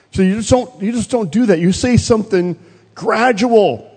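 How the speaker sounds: high-pitched mock reproach; acted exasperated scolding. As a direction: imitate an upset sister correcting someone. Retold sister scolds and instructs.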